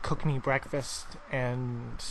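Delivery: ordinary talk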